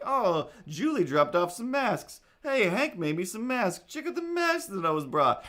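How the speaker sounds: high, loud mock brag about masks; imitating excited neighbours. Imitating neighbours bragging about their masks, gushing. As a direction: show off masks in a gushy voice. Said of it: "Everybody is bragging and very excited".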